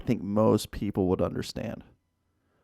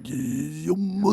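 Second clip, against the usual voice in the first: mock Japanese accent; 5 semitones higher, slower than usual. Echoing the joke accent.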